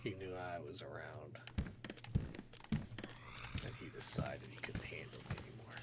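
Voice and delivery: soft unvoiced whisper. Real, not acted. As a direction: breathe it in a smug hush. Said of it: "there's no reason why I'm whispering that".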